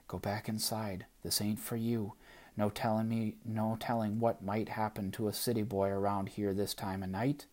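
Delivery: soft, ominous country warning; warning wind voice. Acted. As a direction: hush it like the night. Wind's voice warning.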